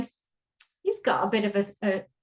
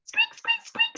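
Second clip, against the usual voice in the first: extremely high, tiny squeaks; squeaking. A narrator's reading. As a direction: squeak like a pair of shoes. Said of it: "squeaked his shoes".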